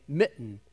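"mitten" as mi'n, with a catch in the throat; "mitten" with glottal stop. Shown as a demonstration. Explaining the stopped air, "mitten" with glottal stop. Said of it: "You're actually stopping the air".

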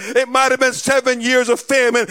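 loud, rising preacher's cry; preaching at full force. Acted. Preacher rallying the congregation, loud and soaring. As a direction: build the church to a roar.

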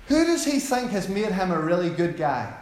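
usual speaking voice

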